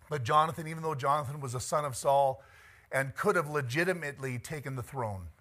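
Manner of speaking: ordinary talk, nothing marked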